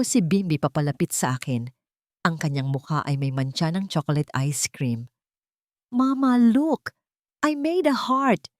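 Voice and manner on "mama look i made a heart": put-on high, eager little-kid voice; excited child. A narrator's reading. Little girl showing her mother a clay heart, excited. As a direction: show off proudly like a small child.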